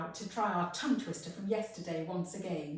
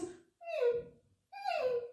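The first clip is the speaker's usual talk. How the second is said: tiny squeaky mew, very high; frightened puppy. Shown as a demonstration. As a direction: whimper like a scared little puppy. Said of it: "he's a little bit frightened".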